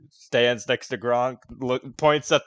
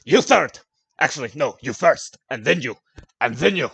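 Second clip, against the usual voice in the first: put-on drunken shout; 2 semitones higher, 8 dB louder than usual. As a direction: bellow the order like a drunk. Gronk counting drunkenly, shouting.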